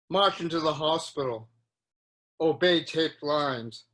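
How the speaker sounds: measured poetry recital with deliberate pauses; poem reading. Acted. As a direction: read each short line as its own image, pausing between them. Reads his poem aloud.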